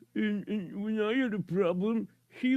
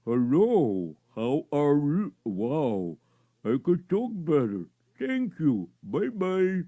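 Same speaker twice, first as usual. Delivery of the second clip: overdone mouthing, lower and louder; exaggerated mouth movement; 6 semitones lower, 5 dB louder, slower than usual. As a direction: lower and louder, jaw working wide on every word. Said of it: "move your mouth up and down a little more".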